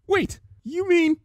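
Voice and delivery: high, slow startled stammer. A narrator's reading. Twilight stammering as the truth dawns. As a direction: stammer in dawning shock. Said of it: "Twilight stammered".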